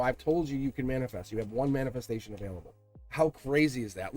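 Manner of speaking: ordinary talk, nothing marked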